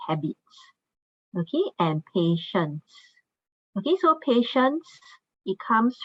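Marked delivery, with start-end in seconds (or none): 0.1-0.4 "habits" modelled as two syllables, "HAB-its"; teacher's model of "habits", no extra vowel at the end; pronunciation demo. Shown as a demonstration. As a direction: show learners how "habits" ends in a plain "its". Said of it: "we pronounce this as".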